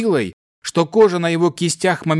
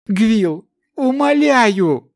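begging cry; 8 semitones higher, much slower than usual. A narrator's reading. Boy begs his beloved.

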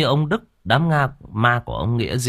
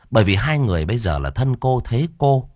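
ordinary delivery, nothing marked

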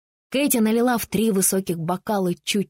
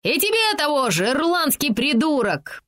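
high cheeky yell; 6 semitones higher, 3 dB louder than usual. A narrator's reading. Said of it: "проорал Нилли".

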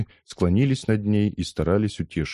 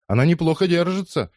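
high, impressed call; excited spectator; 11 semitones higher, 4 dB louder than usual. A narrator's reading. Spectator backing Лиза in the fight, excited high call. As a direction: cheer the underdog with pitch raised.

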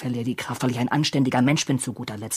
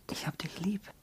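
whispered, softer and higher; a man whispers his love to Anna; whispered confession; 6 semitones higher, 10 dB quieter than usual. Acted. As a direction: breathe it to her tenderly.

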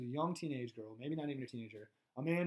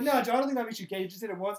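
mock reassuring friend; 6 semitones higher, 7 dB louder, faster than usual. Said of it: "I'd be like".

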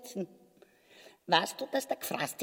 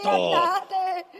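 halb lachend flehend; 7 semitones higher, 7 dB louder than usual. Acted. Frau fleht um Gnade.

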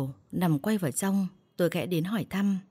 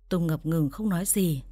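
ordinary delivery

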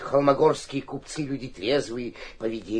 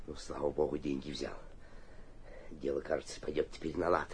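relieved aside; 10 semitones lower, 14 dB quieter than usual. Acted.

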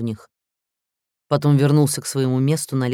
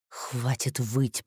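very soft, slow menacing hiss; 6 dB quieter, much slower than usual. Acted. President hisses at the crying player to stop. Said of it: "прошипел он".

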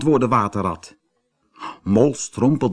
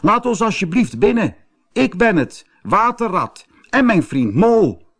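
high, urgent plea at the door; calling out; 9 semitones higher, 3 dB louder than usual. A narrator's reading. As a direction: call out pleadingly to be let in. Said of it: "riep Waterrad". (dringend en hoog roepend)

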